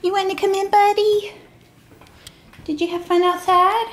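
high sing-song voice to the cats. Real, not acted. Calling Salem and Peps in from outside, high baby talk. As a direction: coo and call the cats in.

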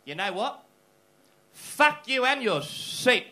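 loud, slowing into a drawn-out yell; old man's mock fury. Old guy leaving pregnant lady the seat, mock furious yell. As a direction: build to a hammed-up parting shout. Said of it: "turns back to the pregnant lady and just goes".

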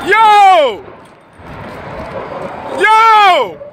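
loud, high alarmed yells at the crash; yelling yo in real shock. Real, not acted. Bystander filming a burning trailer, shouting in alarm. Witnessing a fiery highway crash.

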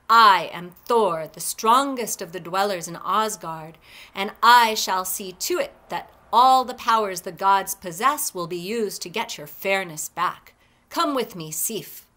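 loud, forceful vow with hard emphasis; mighty rage. A narrator's reading. Thor vows to restore his wife's hair, enraged. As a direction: declare it like a mighty warrior god. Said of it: "he grew into a mighty rage".

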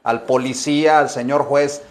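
normal talking voice

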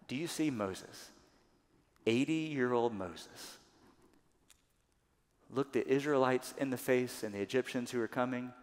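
ordinary talk

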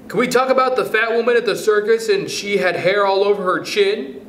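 put-on high child's voice, begging; begging pupil. A narrator's reading. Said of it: "begged Isidore Feinberg reminiscently".

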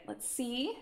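ordinary talk

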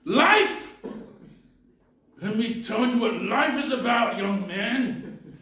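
put-on loud, high, bitter cry of an old man; old man flaring up at the young visitor, loud and bitter; bitter old man's outburst. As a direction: shout it out like a man who lost everything.

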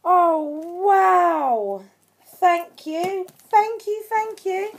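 awed wow climbing into high thanks; overexcited awe. Real, not acted. Vlogger awed by her friend's gift, overexcited. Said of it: "I need to calm down".

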